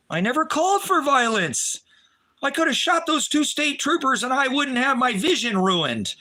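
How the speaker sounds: upset outcry. Real, not acted.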